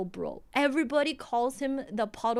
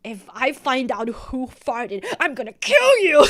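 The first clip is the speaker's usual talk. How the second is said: angry threat with laughter; 8 dB louder than usual. Retold or imitated. Narrator mimics the angry classmate threatening the farter, laughing. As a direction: threaten the culprit, cracking up by the end. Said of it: "he was super angry".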